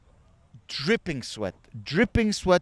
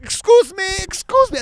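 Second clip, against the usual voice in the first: high, loud insistent calling; pushy Greek; 8 dB louder than usual. Retold or imitated. Greeks cutting everyone at the boat, loud mock politeness. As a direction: push through with a pitched-up, exaggerated call. Mimics Greeks shoving through the queue.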